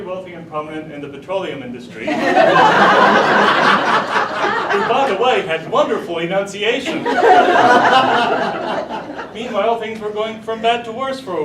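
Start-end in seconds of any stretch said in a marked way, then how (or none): 2.1-4.7 high, loud burst of laughter. Real, not acted. Teller laughs at own joke. 7.0-9.3 loud rolling laugh; loud laughter. Real, not acted. Teller laughs at own joke.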